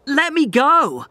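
high, loud frantic shout; yelling at the doctor who grips his arm. Acted. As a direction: struggle free and yell it. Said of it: "I shouted".

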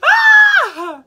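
sharp high yelp; high shout. Real, not acted. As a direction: yelp in alarm. Scream at sibling.

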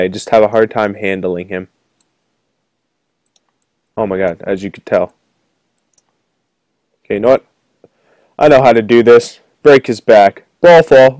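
normal talking voice

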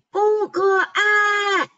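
high, slow shrieked protest; shrill cry. A narrator's reading. 短尾矮袋鼠尖声叫. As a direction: screech it out slowly. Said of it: "尖声叫道".

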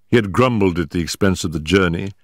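ordinary talk, nothing marked

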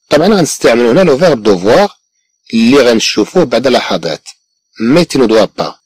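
ordinary talk

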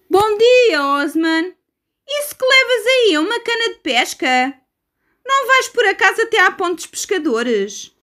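very high squeaky bird voice; seagull voice. Acted. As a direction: chirp it like a cheeky gull. Seagulls greet Osman.

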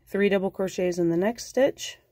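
ordinary talk, nothing marked

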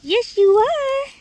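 high, loud sing-song pet voice; squeaky doggy talk telling them off. Real, not acted. Gardener teasing her dogs on flowers.

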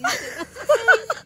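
high-pitched helpless laugh; real giggle fit. Real, not acted. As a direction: burst out in a squealing laugh. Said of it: "हंस हंस के पेट में दर्द".

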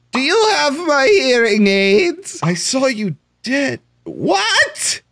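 put-on high, slow old granny voice; high, slow granny who can't hear; grandma voice. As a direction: play a deaf grandma asking. Speaks for deaf grandma.

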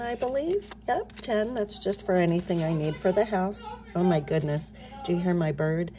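ordinary delivery

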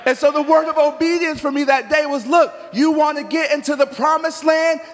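loud, high, shouting call-and-response; preaching at full force. Acted. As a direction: whip the congregation up with shouts.

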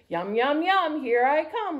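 plain reading voice

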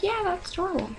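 high-pitched mock gush; high, airy retold enthusiasm; gushing quoted reply. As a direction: coo it high and light, imitating your own delighted reply. Acts out her reply to mom.

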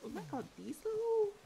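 high, loud burst of delight; high delighted squeal of oh my god; excited gasp. Real, not acted. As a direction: gush out oh my god. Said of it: "And I'm about to say".

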